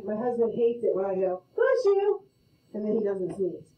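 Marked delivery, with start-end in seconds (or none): 1.6-2.2 higher and louder, playful; bright teasing bless you. Retold or imitated. Wife teasing her husband before his sneeze, playful loud call.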